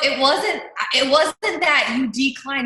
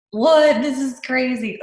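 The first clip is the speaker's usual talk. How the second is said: amazed; 3 semitones higher, 5 dB louder, much slower than usual. Retold or imitated. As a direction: react astonished, retelling the moment. Acting out amazement at the message.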